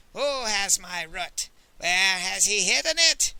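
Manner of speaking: slow, swelling ghostly demand; wrathful spectre. Acted. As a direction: demand it slowly, building to a loud boom. Spectre's interrogation continues.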